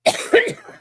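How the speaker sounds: sharp, harsh real cough; real coughing fit. Real, not acted. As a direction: hack out a hard cough. Smoker coughing fit on stream.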